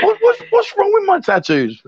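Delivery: very high, mock-wounded whine; mock hurt. Defending his tattoos to teasers, mock-hurt squeal. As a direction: squeal it in pretend offence.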